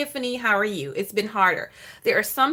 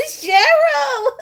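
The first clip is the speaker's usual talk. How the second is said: high, louder name with a laugh; greeting a viewer while laughing; 7 dB louder than usual. Real, not acted.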